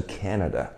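Canada softly, with a tiny n; barely touch the n in Canada after a stressed CAN; light-n Canada. Shown as a demonstration. Teacher showing students the quiet n, light-n Canada. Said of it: "这个N很小声".

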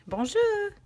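high, sung-out French greeting; lilting French-accented hello; proper French pronunciation. Shown as a demonstration. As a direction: show off a practised French accent. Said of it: "See, I said it properly".

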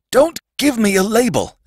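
high-pitched desperate protest; sudden frightened cry. A narrator's reading. Sandman begging the boy not to label him, crying out. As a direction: cry out in alarm. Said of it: "cried the Sandman suddenly".

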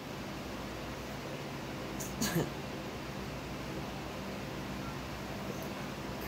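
long unvoiced wheezing laugh; long breathless laugh. Real, not acted. Laughing at herself after ranting about the mail lady, breathy wheeze.

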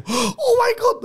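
very high, squealed excitement; hammed-up high-pitched awe; mock amazement. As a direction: gasp it out like a thrilled kid. Acts out childhood thrill at cards.

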